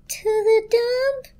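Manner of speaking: very high, slow, wailing blanket; hysterical shriek. A narrator's reading. Blanket despairing to the toaster, high hysterical wail. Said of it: "shrieked the blanket hysterically".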